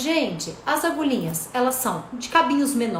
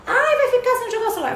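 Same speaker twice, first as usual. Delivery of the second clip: high, quick whiny mother's threat; mock nagging mother; 10 semitones higher, 3 dB louder than usual. Therapist mimics mothers threatening kids, high whiny nagging. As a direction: mimic a mother bribing her child. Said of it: "O que eu vejo no consultório assim".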